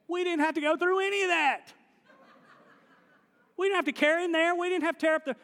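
high, loud exasperated protest; indignant friends. Acted. Friends of the paralytic protesting their wasted work, loud and high. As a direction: whine like the men who carried him. Crew voiced complaining about effort.

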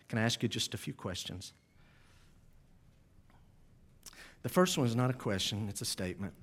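soft, low pastoral plea; hushed altar call. Real, not acted. Pastor pleading with congregation before prayer, hushed altar call. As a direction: bring it down gently for bowed heads.

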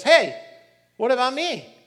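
put-on high, loud kid's protest; left-out kid. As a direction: whine like the forgotten little brother. Third son left out, protesting.

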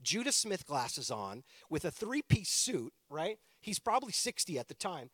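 ordinary talk, nothing marked

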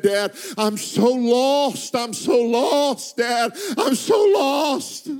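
high, slow, building tearful cry; sobbing cry. Retold or imitated. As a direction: cry it out, rising to a wail. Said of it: "through tears".

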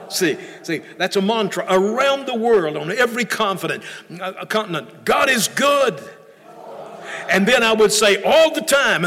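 fervent preacher, rising and falling; preaching. Acted. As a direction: preach it to the congregation. Pastor preaching to congregation.

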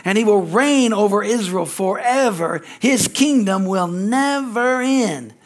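scripture reading. Acted. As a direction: read the verses out to the congregation. Reads Luke passage aloud.

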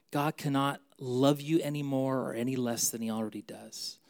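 normal talking voice

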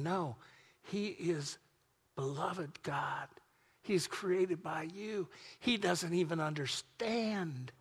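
hushed, slow intercession; soft pleading. Acted. Jesus pleading softly to God for Chip. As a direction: plead softly on his behalf.

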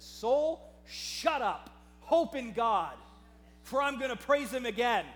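loud, emphatic order to his own soul; commanding self-rebuke. Acted. David silencing his pouring-out thoughts, commanding self-rebuke. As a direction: order your soul firmly, at preaching volume. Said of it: "I'm gonna do some speaking".

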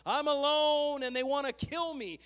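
preacher's hammed-up lament; mock complaint. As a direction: cry it out as Elijah's grievance. Voicing Elijah's complaint.

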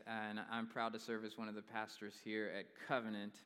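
normal talking voice

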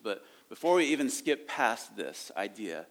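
usual speaking voice

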